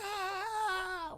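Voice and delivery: high, soft imitated squeal; mock scream. Imitating her getting drenched, high mock scream. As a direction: squeal thinly as if hosed down. Imitates woman being sprayed.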